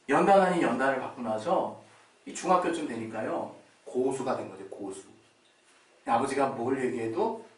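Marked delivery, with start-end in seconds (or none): none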